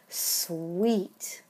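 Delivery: ordinary talk, nothing marked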